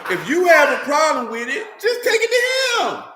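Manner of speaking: loud, high preaching shout with a laugh; fired-up preacher yell. Real, not acted. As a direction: call it out to the doubters.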